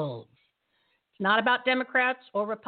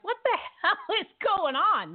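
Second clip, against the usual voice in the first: incredulous quote; 9 semitones higher than usual. Retold or imitated. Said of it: "I'm like".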